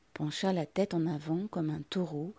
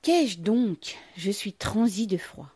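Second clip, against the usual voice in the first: higher, louder exclamation; crying out; 3 semitones higher, 6 dB louder, slower than usual. A narrator's reading. Mother hiding her agitation, crying out. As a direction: exclaim to cover your agitation. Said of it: "elle s'écria pour dissimuler son trouble".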